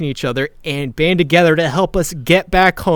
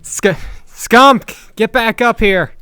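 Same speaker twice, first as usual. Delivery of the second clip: higher, insistent call; 6 semitones higher, 4 dB louder, slower than usual. Acted. Rider calls Skunk back up the tower, raised call. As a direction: summon someone from far below.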